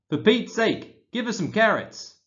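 high, loud, fed-up cry of a tired homeowner; exasperated human shout. Acted. The wombat's human snaps at it from the house, exasperated and loud. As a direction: blurt it out, sick of the wombat chewing the door.

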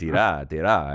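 low, quiet imitation in a Japanese accent; Japanese-accented teacher. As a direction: softly mimic the teacher's Japanese-accented words. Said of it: "And he said".

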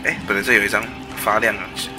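usual speaking voice

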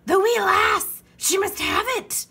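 very high, loud alarmed holler; Braidbeard hollering. A narrator's reading. Pirate captain realising baby has map, hollered. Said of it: "Braidbeard hollered".

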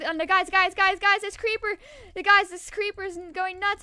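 loud, high urgent call to the team; calling for help. Real, not acted. Gamer shouting to teammates about a creeper, loud and urgent. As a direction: yell for the guys to notice.

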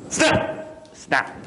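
abrupt, voiceless storybook snaps; sharp, separate bursts; sudden snap. A narrator's reading. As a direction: pounce on each word as a sound effect. Owl snaps up the rat.

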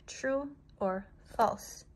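drawn-out hushed ask; slow whispery true or false; slow, soft, eerie question. Shown as a demonstration. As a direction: breathe it slowly and softly. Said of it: "Let's do that again".

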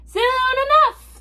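high, loud, drawn-out crew chorus; long, high-pitched loud echo. Acted. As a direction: call it back slowly like a whole pirate crew. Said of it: "the crew repeated".

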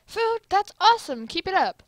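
loud, high burst of enthusiasm; excited America. Acted. America praises Lithuania setting out food, loud and excited. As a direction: cheer him on, thrilled about food.

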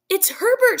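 high, loud screaming cry; a mother hearing her son; desperate scream. A narrator's reading. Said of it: "she screamed".

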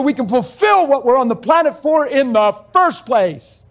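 full-force sermon delivery; fiery preaching. Acted. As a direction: preach it out to the congregation.

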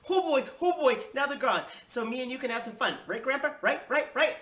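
put-on high, loud, giddy kid; bubbling over, high and loud; excited child. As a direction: cheer eagerly, pestering for agreement. Child celebrates parents leaving, jumping.

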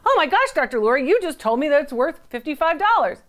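voiced-up surprise, as if challenging the expert; protesting viewer.

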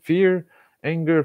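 usual speaking voice

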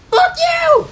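very high, loud shriek; screamed curse. Real, not acted. Gamer cursing an opponent, high-pitched scream. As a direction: scream it at the top of your range.

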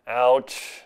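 normal talking voice

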